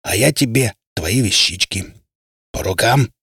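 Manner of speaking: usual speaking voice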